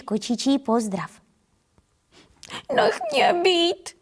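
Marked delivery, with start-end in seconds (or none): 2.7-4.0 high, slow, whimpering little tomcat; tearful mew. Acted. Frightened little tomcat to the kitten, high tearful mew. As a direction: plead to be left alone on the edge of tears. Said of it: "zamňouká kocourek plačtivě".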